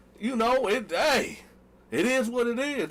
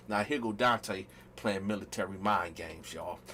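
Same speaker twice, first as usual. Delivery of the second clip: low aside; 12 semitones lower, 8 dB quieter than usual. Real, not acted. Aside to audience.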